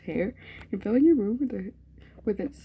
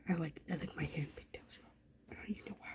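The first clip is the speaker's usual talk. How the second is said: whisper; 4 semitones lower, 16 dB quieter, faster than usual. Acted. Reading reader's line aloud.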